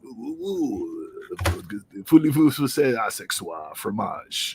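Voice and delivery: mock French accent, hammed-up. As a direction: fake French accent, slow and breathy. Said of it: "I can't even do a French accent".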